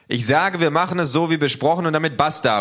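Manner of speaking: laut, hoch und bestimmt rufen; Ausruf. Acted. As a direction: ein Machtwort ins Getümmel rufen. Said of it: "ruft er". (laut und energisch gerufen)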